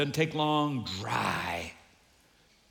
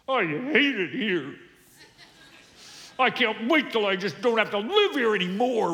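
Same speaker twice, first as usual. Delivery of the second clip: mock teen whine; 4 semitones higher than usual. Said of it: "While you say".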